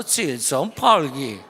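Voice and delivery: slow, drawn-out mock Chinese accent on 칠 점 팔 기. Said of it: "좀 중국말 하는 것 같지 않아요?".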